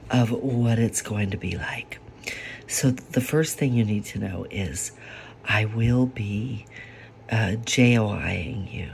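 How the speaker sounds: usual speaking voice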